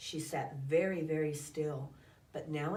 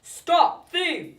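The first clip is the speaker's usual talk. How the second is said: loud angry shout after the thief; calling out; 11 semitones higher, 14 dB louder than usual. A narrator's reading. Gardener chasing a rabbit, furious shout. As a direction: bellow it as he chases with a rake. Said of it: "calling out".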